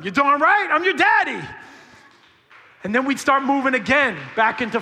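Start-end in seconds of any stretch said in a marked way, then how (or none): 0.0-1.4 loud, high joyful declaration; proud shout. Retold or imitated. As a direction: belt it out like a proud dad. Father embraces returning daughter.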